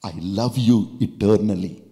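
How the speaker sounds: fervent preaching voice, voicing the Lord; preaching as God. Preacher speaking God's words to the congregation, fervent. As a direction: proclaim it like God speaking to his child.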